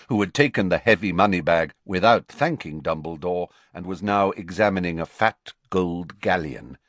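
ordinary delivery

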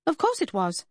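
high-pitched disbelieving retort; incredulous. A narrator's reading. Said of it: "she responded incredulously".

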